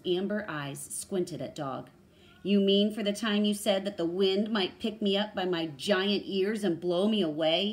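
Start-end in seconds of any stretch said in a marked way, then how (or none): 2.4-7.7 loud, lightly acted character voice; animated animal character, loud; storybook serval questioning. A narrator's reading. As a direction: challenge Dog with a projected question.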